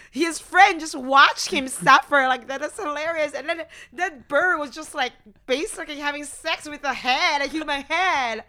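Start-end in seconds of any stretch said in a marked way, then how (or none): none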